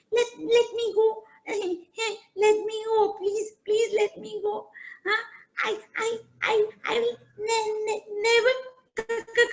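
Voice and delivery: a tiny mouse begging with chattering fear; frightened mouse pleading with the lion; stammering scared mouse. Acted. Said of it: "the mouse was shivering".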